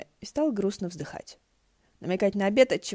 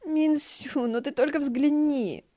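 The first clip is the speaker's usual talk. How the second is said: excited; 7 semitones higher, 3 dB louder, slower than usual. Acted. Excited friend calls attention.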